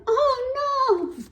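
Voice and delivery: put-on high, loud "oh no" of a little girl; hammed-up shocked little girl; dismayed cry. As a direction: cry out as she sees her thing ruined. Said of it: "She's going".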